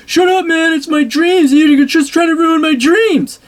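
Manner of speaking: high, whining mock of an upset kid; mocking a kid defending his dreams, high and whiny. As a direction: squeal it like a wounded teenager. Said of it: "And he was like".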